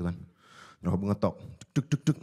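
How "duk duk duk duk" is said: ordinary talk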